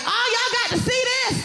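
high mock boast; mock proud New Yorker. Mocking the founders showing off the islands, high mock boast.

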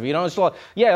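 ordinary talk, nothing marked

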